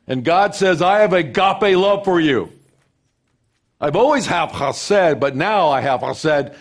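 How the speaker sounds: loud, high, ringing scripture proclamation; full-force preaching. Acted. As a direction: proclaim it to the whole congregation. Preacher builds to a climax.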